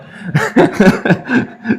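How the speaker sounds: a big, high, helpless laugh; high burst of laughter. Real, not acted. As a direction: crack up laughing at your own slip.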